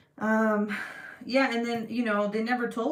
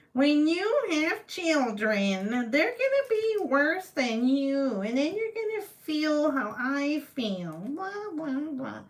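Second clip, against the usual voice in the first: higher, very slow ominous mom voice; mock mom curse; 8 semitones higher, 4 dB louder, slower than usual. Quoting her mom's curse in a slow, higher, mock-ominous voice. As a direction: drag it out like a mother's curse. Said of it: "not that my mom sounds like that".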